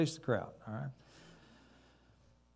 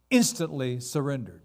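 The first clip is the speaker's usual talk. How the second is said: usual speaking voice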